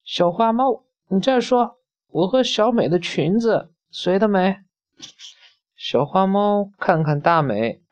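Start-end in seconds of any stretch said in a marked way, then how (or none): 0.0-4.6 put-on much higher, pushy little girl demanding an answer; pitched up high and insistent, a touch louder; shrill and loud. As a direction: Damei pressing the kitten, shrill and demanding. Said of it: "声音又尖又响".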